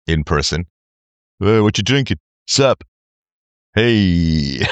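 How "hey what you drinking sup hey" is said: a hint of a dopey pickup line; lightly voiced clumsy guy; dumb guy voice. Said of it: "talk to women about if guys approach them".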